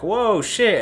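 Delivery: a hammed-up surprised exclamation; mock amazement. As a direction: gasp in awe at the sight. Seeing the underwater city.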